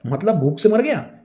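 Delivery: high, loud disbelieving question; shocked. Acted. Assistant misunderstands that Prabhat died, shocked. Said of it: "assistant shock होते हुए कहता है".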